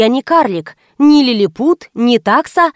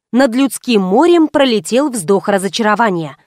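ordinary delivery

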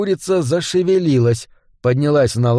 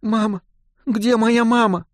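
put-on tearful little girl; 9 semitones higher than usual. A narrator's reading. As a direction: call for mother weakly, close to tears. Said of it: "тихо заплакала".

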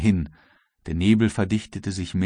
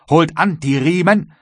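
high, loud rowing order; commanding call; 8 semitones higher, 7 dB louder than usual. A narrator's reading. Captain commands his rowers, high and loud. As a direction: call the crew to the oars. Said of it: "gebot James Playfair".

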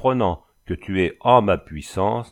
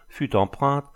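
usual speaking voice

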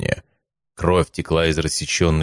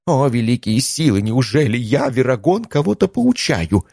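theatrical self-mockery; 7 semitones higher than usual. Mentor mock-marvels at himself.

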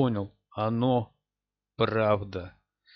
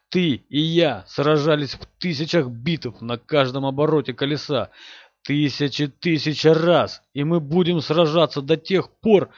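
furious shout; 4 dB louder than usual. Acted. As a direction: roar it at him with scorn. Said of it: "Последние слова он выкрикнул".

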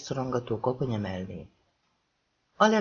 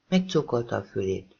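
usual speaking voice

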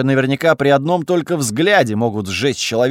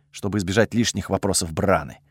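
plain reading voice

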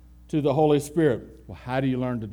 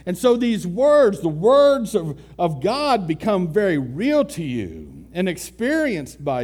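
louder, rising preacher's voice building to a climax; fervent preaching; 2 semitones higher, 4 dB louder than usual. Acted. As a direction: preach it out to the congregation with growing force. Sermon builds to full force.

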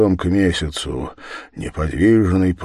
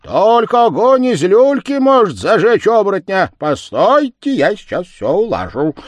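much higher, louder voice; excited character voice; 12 semitones higher, 8 dB louder than usual. Acted. Someone offering to settle it all, high and loud. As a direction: call out high and forceful, taking charge. Character speaks up in acted dialogue.

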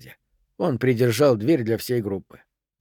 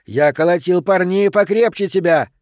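louder, higher fighting words; defiant raised voice; heated boast; 5 semitones higher, 5 dB louder than usual. Acted. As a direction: throw the taunt back forcefully. Boast while grappling.

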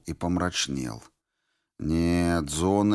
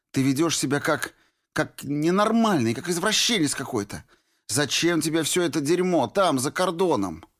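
begging Norris; 10 semitones higher than usual. A narrator's reading. Girl begging the deserter to leave, higher and urgent. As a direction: beg him to flee with you.

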